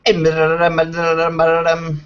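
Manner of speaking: auctioneer voice. Pretend. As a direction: rattle it off like an auction caller. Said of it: "do your little, uh, auctioneer voice".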